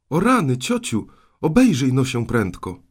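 loud, high cry of warning; urgent trick to make her turn; feigned alarm. Acted. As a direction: shout as if danger is right behind her. Boy fakes alarm to escape beating.

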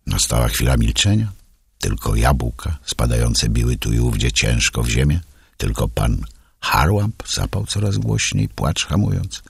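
usual speaking voice

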